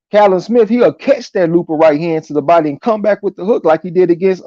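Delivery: ordinary talk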